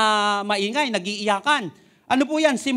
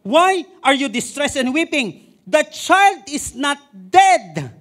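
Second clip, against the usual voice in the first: slow, loud, raised voice of the Lord; Lord's loud question; 6 semitones higher, 7 dB louder, much slower than usual. Acted. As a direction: declare it slowly with authority. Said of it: "sabi ni Lord". (marahan at malakas na tinig ng Panginoon)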